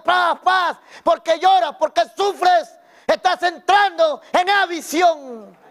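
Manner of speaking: mock call of the Lord, loud then slow. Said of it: "vino la tarde el Señor y comenzó". (el Señor clamando en voz alta y luego despacio)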